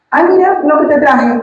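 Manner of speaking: high, sweet, cheery offering voice; mock-sweet caller at the door; sweet visitor. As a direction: play the kind neighbour handing over a gift. Visitors bringing gifts, quoted warmly.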